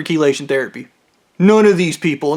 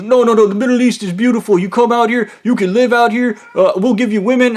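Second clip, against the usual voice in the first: higher, coaxing pitch; coaxing caller; 6 semitones higher than usual. Retold or imitated. Caller coaxing him to move out there. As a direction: sell the Middle East eagerly. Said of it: "And he's".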